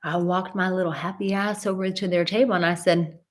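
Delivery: ordinary talk, nothing marked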